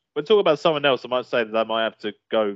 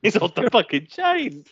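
mock catchphrase; 10 semitones higher, slower than usual. Echoing catchphrase higher.

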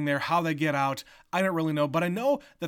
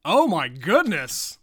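slow, loud gasp of amazement; drawn-out loud oh my goodness; stunned exclamation; 3 semitones higher, 9 dB louder, slower than usual. Real, not acted. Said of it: "What does that mean?".

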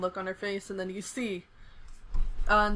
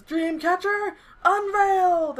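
high, loud, drawn-out proclamation; heroic attack call; 9 semitones higher, 6 dB louder, slower than usual. Acted. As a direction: announce it like an anime finishing move. Hero announces his move.

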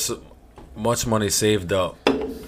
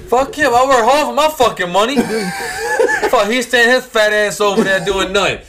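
laughing; 11 semitones higher, 4 dB louder than usual. Real, not acted. Cracking up while ranting.

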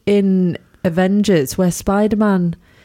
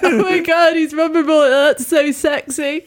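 mock swoon; 9 semitones higher than usual. Joking about fancying vulnerable film heroes, mock swooning. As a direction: coo over the hero as if smitten.

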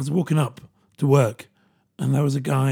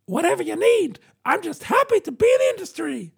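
put-on much higher, chirpy eager-to-please kid; a much higher, mock-keen young trainee voice; plucky eager newbie; 14 semitones higher than usual. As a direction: play the keen young trainee, all bright-eyed. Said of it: "the plucky".

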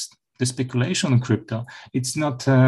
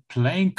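"playing" set apart and pitched higher; stress on PLAYING; 7 semitones higher than usual. Real, not acted. Guest underlining that crypto can be a game, stressing "playing". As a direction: lift the word "playing" to underline it. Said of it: "underline the word playing".

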